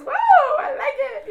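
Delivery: high loud delighted whoa; God voiced excited. Voicing God's delight at her words, high loud whoa. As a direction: voice God, thrilled and laughing. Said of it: "God goes".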